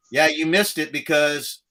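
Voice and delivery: ordinary delivery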